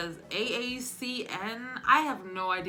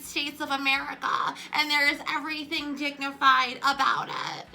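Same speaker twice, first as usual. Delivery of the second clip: loud, high, slow speech with stretched words; put-on urban dialect, drawn out for the crowd; AOC; 7 semitones higher, 6 dB louder than usual. Mimicking a congresswoman speaking to an urban audience, exaggerated dialect. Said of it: "accused of having an urban dialect".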